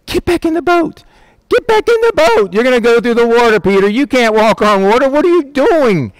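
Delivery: very high, squawking mock panic; hammed-up alarm, pitched far up; panicked disciples. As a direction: plead frantically with a reckless friend. Disciples panic at Peter leaving boat.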